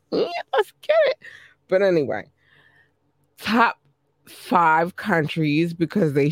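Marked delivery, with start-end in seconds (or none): 0.1-1.2 high, fast hype shout. Real, not acted. Hyping listeners before the premiere, high and fast. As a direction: fire yourself up like a hype man.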